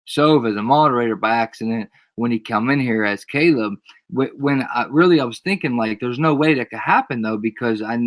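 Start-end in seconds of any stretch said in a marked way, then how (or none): none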